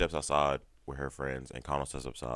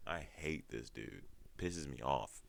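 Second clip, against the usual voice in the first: ordinary talk, nothing marked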